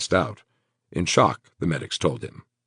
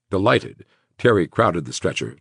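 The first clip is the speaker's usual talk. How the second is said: usual speaking voice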